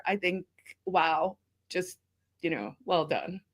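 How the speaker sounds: choked up. Real, not acted. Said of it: "So now you made me cry".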